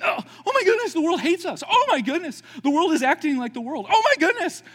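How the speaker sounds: high, loud, freaking-out voice; panicky Christians. Acted. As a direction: squeal like someone shocked all over again. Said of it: "Like they keep".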